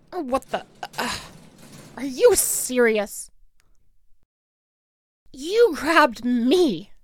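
ordinary talk, nothing marked

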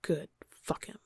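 rough whispered grunt. Acted. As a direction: grunt it under your breath. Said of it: "you grunt out".

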